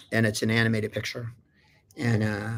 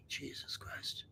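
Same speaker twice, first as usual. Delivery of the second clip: whisper; 22 dB quieter, slower than usual. Retold or imitated. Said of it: "Jennifer's like this".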